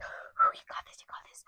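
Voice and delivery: hushed cheering-on; whispered encouragement. Retold or imitated. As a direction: imitate a breathy pep talk. Mom's pep talk, whispered.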